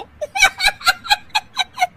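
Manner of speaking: high, loud cackling fit; uncontrollable laughter. Acted. Daughter-in-law laughing at a friend's stained kurta, uncontrollable cackle. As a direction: burst into a long helpless laugh. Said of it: "Anshu फिर से हंसने लगती है".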